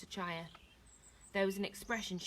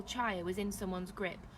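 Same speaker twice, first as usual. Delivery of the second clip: usual speaking voice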